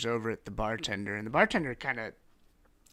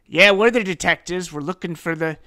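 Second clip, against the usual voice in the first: loud, pitched-up blunt answer; brusque detective; 6 semitones higher, 13 dB louder than usual. Acted. Detective answering a stranger, loud and brusque. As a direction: answer forcefully, asserting authority.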